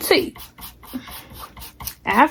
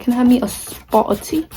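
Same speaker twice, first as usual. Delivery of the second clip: a light, hammed-up British English accent, a bit softer; mock British accent; 3 dB quieter than usual. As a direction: playfully put on a posh British accent asking for tea. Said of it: "I wish I lived in England, so I can be like".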